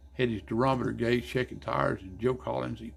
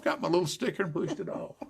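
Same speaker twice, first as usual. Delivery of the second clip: usual speaking voice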